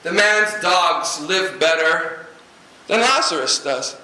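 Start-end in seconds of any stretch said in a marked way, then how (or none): none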